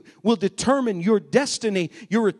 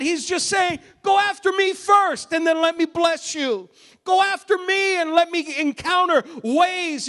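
high, loud preaching of God's call; full-force preaching; 9 semitones higher, 4 dB louder than usual. Acted. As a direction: lift the room and proclaim it.

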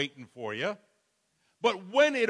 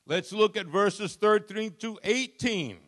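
normal talking voice

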